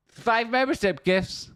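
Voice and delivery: stretched, very high and thrilled; excited high squeal. Real, not acted. Streamer squealing over gifted memberships to chat, thrilled. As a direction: squeal out the gift news in delight.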